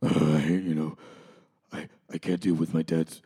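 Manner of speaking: a hint of gloomy Batman moping; Batman impression. Co-host voices Batman whining about his parents, low and brooding. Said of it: "my Batman impression didn't land".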